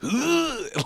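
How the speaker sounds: high, drawn-out groan; mock pained groan. Storyteller reliving the nut crushing, mock pained groan. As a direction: moan as if hit in the crotch.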